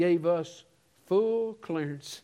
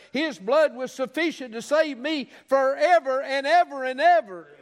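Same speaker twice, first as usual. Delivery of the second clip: high, driving sermon building to a loud peak; impassioned preaching; 7 semitones higher, 4 dB louder than usual. Real, not acted. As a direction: preach with mounting force to the final words. Preaching at full force.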